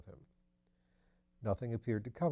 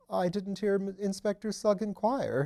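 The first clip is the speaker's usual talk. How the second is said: higher, louder flustered protest; fluster, caught off guard; agitated; 9 semitones higher, 6 dB louder than usual. Acted. Thipps to Lord Peter, higher and agitated. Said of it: "he said with some agitation".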